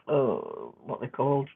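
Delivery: usual speaking voice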